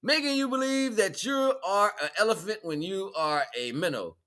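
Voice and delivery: normal talking voice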